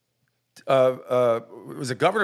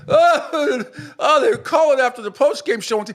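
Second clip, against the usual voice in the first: much higher, louder whiny caricature; mocking Clay Travis; 10 semitones higher, 5 dB louder than usual. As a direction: squeal it like a gushing host. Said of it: "here's Clay Travis".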